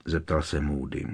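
ordinary delivery, nothing marked

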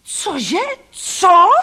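shocked, furious yelp; outraged roar. A narrator's reading. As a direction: roar in disbelief at the insult. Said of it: "zařval".

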